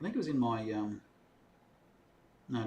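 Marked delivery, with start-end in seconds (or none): none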